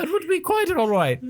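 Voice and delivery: very high put-on prim voice; high British lady voice. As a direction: squeak it like a prim old lady. Voicing the Prime Minister reply.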